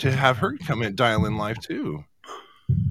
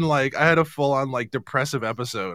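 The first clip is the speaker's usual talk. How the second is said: usual speaking voice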